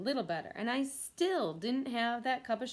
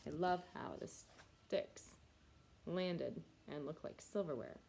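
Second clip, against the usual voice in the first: soft aside; 6 semitones lower, 11 dB quieter than usual. Acted. Quiet aside.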